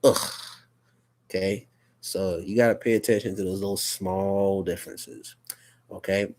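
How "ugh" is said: U said as a short ugh; short vowel U, ugh. Shown as a demonstration. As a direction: say U as ugh, like in cup. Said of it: "the short sound for U is".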